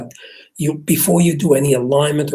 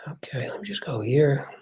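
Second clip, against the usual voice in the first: breathy whisper to himself; half-voiced note to self, unheard by viewers; whispered aside; 7 dB quieter than usual. Real, not acted. Mechanic whispering to himself while scrolling the chat.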